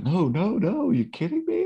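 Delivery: high-pitched mock protest; incredulous denial. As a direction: deny it in a squeaky, put-on voice. Voices Larry's indignant denial.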